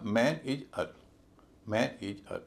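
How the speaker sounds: "man is a" slowly split as MAN, ij, er; heavy MAN, then light separate "ij" and "er" in "man is a"; syllable by syllable. Shown as a demonstration. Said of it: "पहला syllable भारी, बाकी हल्के".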